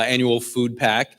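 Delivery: normal talking voice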